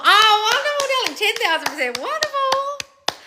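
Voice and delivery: very high, sing-song gush of delight; gushing congratulations. Real, not acted. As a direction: gush it sky-high, stretching the last word right out. Gushing praise for a listener's success.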